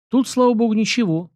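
lightly amused, in the know; knowing chuckle. A narrator's reading. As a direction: answer with a knowing touch of amusement. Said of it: "понимающе усмехнулся князь".

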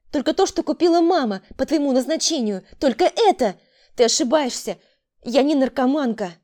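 high, loud self-defence; heated denial. A narrator's reading. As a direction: protest loudly against the accusation. Accused girl defends herself heatedly. (горячо оправдываться)

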